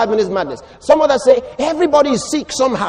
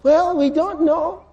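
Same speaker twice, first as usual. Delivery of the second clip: slow, soft, higher mimicked reply; mock uncertainty; 4 semitones higher, 4 dB quieter, slower than usual. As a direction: imitate someone helplessly unsure, drawn out and rising. Mimics an uncertain person's answer.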